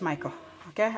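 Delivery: ordinary delivery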